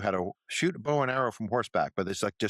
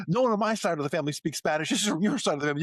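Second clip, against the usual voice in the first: much higher, fast accusing spouse; mock accusing parent; 11 semitones higher, 3 dB louder, faster than usual. Sketch parent blames spouse for the Spanish-speaking baby, much higher voice. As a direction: blame the other side of the family. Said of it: "arguing over the Spanish-speaking baby".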